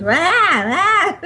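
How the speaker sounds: high playful cat yowl; cat yowl imitation. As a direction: mimic a jealous old cat. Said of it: "that strange sound you hear in the background is his twenty-year-old cat".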